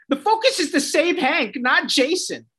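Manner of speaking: high, whiny mock imitation; sing-song it back to ridicule her; mocking Dove. Vlogger mocking Dove's line to viewers, high and whiny. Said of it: "And then she goes".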